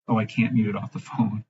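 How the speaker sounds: ordinary talk, nothing marked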